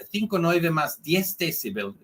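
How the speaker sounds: normal talking voice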